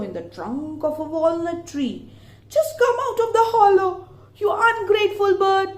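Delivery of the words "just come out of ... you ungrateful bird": high, loud, fast furious yell; angry yell. Acted. Raven yells into the owl's hollow, high, loud and fast. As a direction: bellow at the hiding bird. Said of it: "yelled the angry raven".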